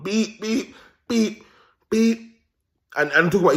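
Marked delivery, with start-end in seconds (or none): 0.0-2.4 higher pitched mock horn beeps; retelling Gary honking at joggers, higher mock horn beeps; imitated car horn. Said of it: "all you're hearing is the horn".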